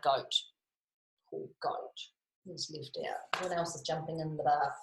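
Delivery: ordinary delivery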